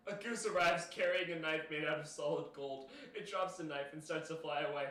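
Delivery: ordinary delivery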